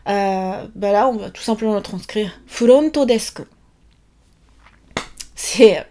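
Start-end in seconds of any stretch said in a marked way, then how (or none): 2.5-3.4 "Furonto desk" with every syllable over-articulated, fu-ro-n-to; exaggerated Japanese katakana English. Shown as a demonstration. Teacher demonstrating katakana English to students, over-articulated. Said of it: "c'est comme si on articulait exagérément".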